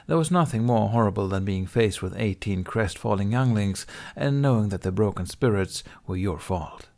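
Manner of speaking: plain reading voice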